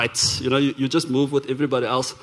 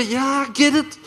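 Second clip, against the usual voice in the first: higher-pitched retold irritation; mock impatience, pitched up; mildly irritated; 7 semitones higher than usual. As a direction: brush it off, a touch annoyed. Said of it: "I'm getting a little irritated".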